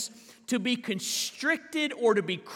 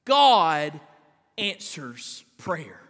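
"God" punched loud, then "answers prayer" slow and low; emphatic statement; 6 semitones lower, 5 dB louder, much slower than usual. Shown as a demonstration. Preacher to congregation after "You ready?", weighty emphasis. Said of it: "You ready?".

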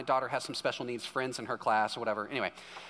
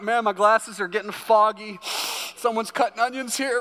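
loud, high excuse from a tearful dad; hammed-up covering for tears, pitched up and loud; mock emotional; 6 semitones higher, 7 dB louder than usual. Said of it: "I was getting so emotional".

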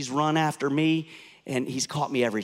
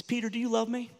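asked higher, a tender searching question; a higher voice, an open, earnest question; raised gentle question; 9 semitones higher, faster than usual. Acted. Jesus asks Peter the first time.

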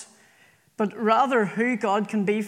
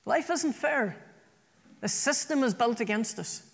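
ordinary talk, nothing marked

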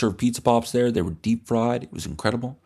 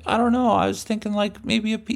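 put-on timid kid; 11 semitones higher than usual.

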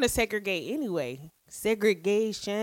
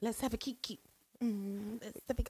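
breathy diva voice; 2 semitones lower, 14 dB quieter, faster than usual. Pretend. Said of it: "I slipped into that one".

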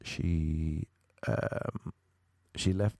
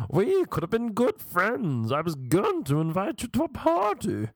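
theatrical wounded snob; 10 semitones higher than usual. Retold or imitated. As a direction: stretch it out with hammed-up affected hurt.

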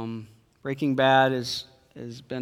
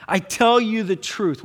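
higher and louder, weight on tell; solemn preaching; 7 semitones higher, 6 dB louder than usual. Acted. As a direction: pronounce the words to the crowd. Preacher quoting Jesus.

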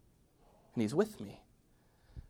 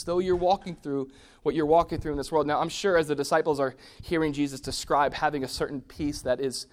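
ordinary talk

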